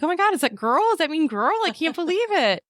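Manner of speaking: high, giddy gasping disbelief; excited disbelief. Retold or imitated. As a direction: squeal the news you can't believe. Gender reveal, quoting herself.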